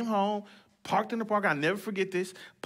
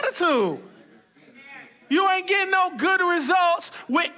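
wag a finger at a bad attitude; a playful high scolding voice; mock scolding; 8 semitones higher than usual.

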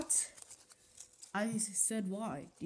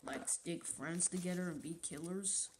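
normal talking voice

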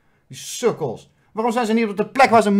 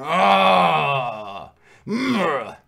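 whiny groan; 3 semitones lower than usual. Retold or imitated. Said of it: "Zo van".

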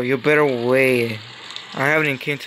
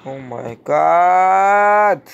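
long, raised cry of disbelief; drawn-out exclamation; 4 semitones higher, 4 dB louder, much slower than usual. Real, not acted. Player reacting to the game, drawn-out exclamation. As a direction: stretch it right out, pitched up. Exasperated exclamation during play.

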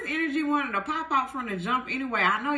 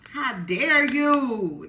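slow, louder, drawn-out indignant demand; hammed-up slow jealous "how dare you"; feisty; 5 dB louder, much slower than usual. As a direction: stretch each word out in mock outrage. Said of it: "Come on now with the feistiness".

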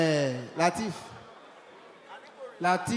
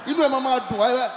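normal talking voice

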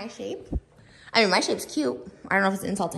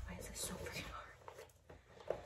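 whispering; 16 dB quieter, slower than usual. Real, not acted. Straining with the shoes.

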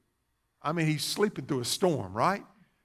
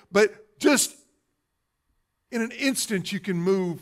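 raised, louder pulpit voice; forceful preaching; 7 semitones higher, 7 dB louder than usual. Acted. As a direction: drive the storm's force home.

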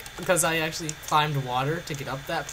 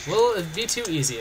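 usual speaking voice

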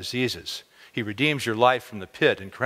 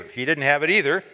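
usual speaking voice

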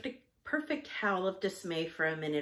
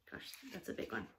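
ordinary delivery, nothing marked

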